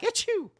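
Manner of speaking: high-pitched mock sneeze; imitated sneeze. Shown as a demonstration. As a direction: fake a quick, high "achoo". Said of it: "Sneezed like".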